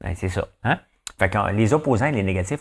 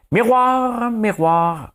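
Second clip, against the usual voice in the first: magic mirror call; 14 semitones higher, 4 dB louder, much slower than usual. Pretend. Fairy tale mirror call.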